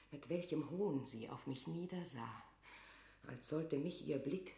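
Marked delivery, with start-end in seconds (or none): none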